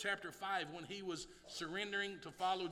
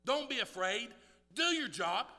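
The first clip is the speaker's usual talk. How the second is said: louder, higher firm command; firm exhortation; 5 semitones higher, 8 dB louder than usual. Acted. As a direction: lift it up as a charge to the church. Louder repeat of the assurance.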